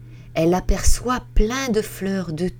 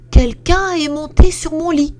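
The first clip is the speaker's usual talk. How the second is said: higher and louder mama bear; middle bear; 10 semitones higher, 7 dB louder than usual. Acted. Middle bear finds her bed used, high and loud. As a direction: middle bear pitched up, indignant. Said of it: "Le moyen ours dit".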